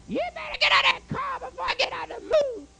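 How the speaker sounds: put-on high, shouted girlfriend impatient from the grass; girlfriend yelling. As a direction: yell at him to get out of the car. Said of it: "She yells at me".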